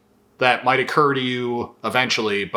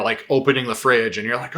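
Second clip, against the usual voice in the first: ordinary delivery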